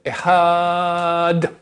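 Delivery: "Echad" with the a held long, then a clean d; slow "Echad", no vowel after the d; correct long ending. Shown as a demonstration. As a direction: stretch "Echad" on its second syllable. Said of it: "Donc, on fait".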